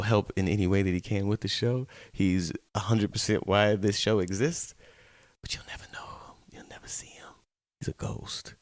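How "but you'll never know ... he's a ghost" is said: hushed ghost-story whisper; spooky whisper. Pretend. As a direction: whisper it like a secret.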